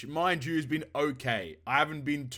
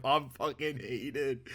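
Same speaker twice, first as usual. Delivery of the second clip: normal talking voice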